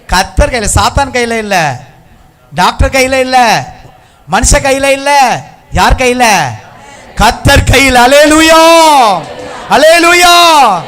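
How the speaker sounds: high, slow shouted declarations building to Hallelujah; full-force preaching. Real, not acted. Preacher leading everyone in a declaration, loud drawn-out cries. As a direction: belt out each phrase for the crowd to echo.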